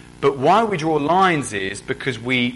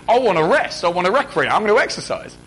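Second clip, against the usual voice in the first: higher, louder keen voice; eager retort; 6 semitones higher, 5 dB louder than usual. Retold or imitated. Other churchgoers defending Sunday exercise, eager and louder. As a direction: bounce it out eagerly. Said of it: "Other people say".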